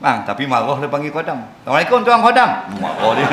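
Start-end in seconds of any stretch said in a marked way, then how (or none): 1.6-2.5 higher, louder teasing salute; mocking greeting. Preacher mocks a greeting to a government worker, teasing. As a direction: greet him with a grin, rubbing it in. Mock greeting calling someone servant.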